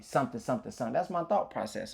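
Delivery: usual speaking voice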